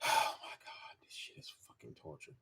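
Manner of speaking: whispered, after a sigh, worn out; sigh, then breathe out the words; drained whisper. Real, not acted. Exhausted by the book.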